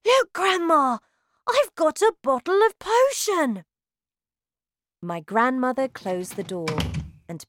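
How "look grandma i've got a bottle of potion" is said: put-on high, loud excited child; excited cry. A narrator's reading. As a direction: burst in with thrilled news. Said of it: "I ran in crying".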